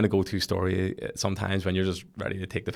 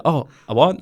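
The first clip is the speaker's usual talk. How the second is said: surprised; 7 semitones higher, 9 dB louder than usual. Retold or imitated. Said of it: "And he said".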